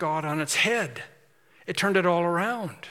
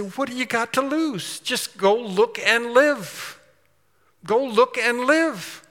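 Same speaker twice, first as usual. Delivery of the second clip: higher, louder, slow pleading; urging friend; 7 semitones higher, 4 dB louder, slower than usual. Retold or imitated. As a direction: beg a dying friend to just go look. Urging a bitten friend to look.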